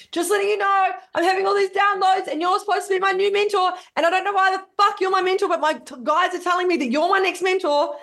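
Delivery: high-pitched, insistent re-enactment; agitated message. Retold or imitated. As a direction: blurt it out high, like a frantic video message. Re-enacting her agitated video message.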